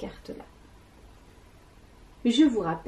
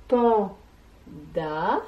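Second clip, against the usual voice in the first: "panda" as pan... da, rising at the end; very slow "panda", one syllable at a time; split into syllables. Shown as a demonstration. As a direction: sound out "panda" with a long gap. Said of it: "des syllabes qui composent".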